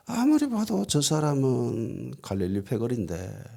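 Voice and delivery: drawn out, starting high and softening; musing aloud with slow suspicion; suspicious musing. Acted. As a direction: slowly puzzle it out while eyeing a suspect. Maid studies Peter suspiciously.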